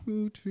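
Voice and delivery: drawn-out, soft mock train whistle; imitated train noise. Imitating a train while mocking film.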